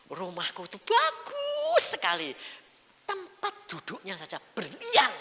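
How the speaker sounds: high, slow, stretched-out amazement; awestruck marvelling. Retold or imitated. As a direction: draw out each praise in wonder. Memerankan kekaguman melihat surga. (kagum dengan nada tinggi memanjang)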